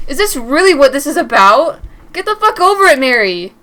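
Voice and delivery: loud furious shout at her; angry yell. Acted. Angry boyfriend yelling at Mary for coming home late. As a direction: yell it at her the moment she walks in.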